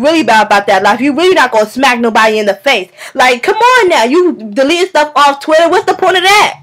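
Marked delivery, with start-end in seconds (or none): none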